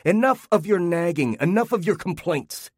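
husband ranting at his wife's nagging; yelling on. Acted. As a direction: keep the fury going. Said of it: "he yelled".

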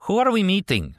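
high-pitched, uneasy question. A narrator's reading. Passenger asking the driver about the meeting, uneasy. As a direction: ask it high, with a touch of nerves. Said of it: "Samut inquired uneasily".

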